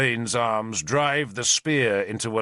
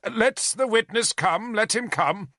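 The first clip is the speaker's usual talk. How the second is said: high, sarcastic "Let the witness come" rising on "the"; mocking echo; 11 semitones higher than usual. Owain mocking Arthur's order, high sarcasm. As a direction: mimic the king's order with scorn.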